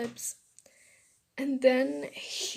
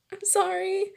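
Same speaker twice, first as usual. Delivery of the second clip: high, small apologetic voice; guilty apology; 8 semitones higher, 4 dB louder than usual. Acted. Girl to boy after kissing him, guilty. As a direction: apologize in a high, anxious pitch.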